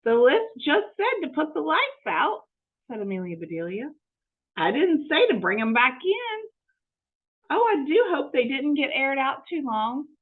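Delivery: eager, innocent housekeeper talking to herself; Amelia Bedelia voice. Acted.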